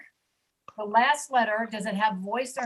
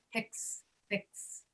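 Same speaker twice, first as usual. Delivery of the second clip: ordinary talk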